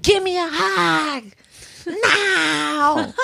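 put-on high whiny little-girl demand; demanding child. Mom imitating her daughter's high whiny demand for a hug. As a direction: whine it like a toddler who wants a hug. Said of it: "And she's like".

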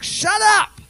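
high, loud mock rebuke; mock God shout. God tells the chattering worshipper to hush, in a high, loud mock shout. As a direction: bark it like God cutting in.

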